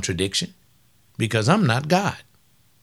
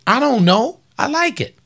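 high pleading whine; 12 semitones higher, 3 dB louder than usual. Retold or imitated. Playing himself, defiant and pleading.

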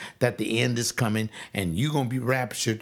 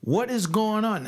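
high incredulous mock question; lightly voiced baffled Nicodemus; 7 semitones higher than usual. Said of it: "asking Jesus".

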